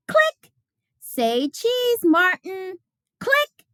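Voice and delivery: put-on high, playful little girl voice with camera clicks; a delighted child snapping photos; cheerful Ruthie.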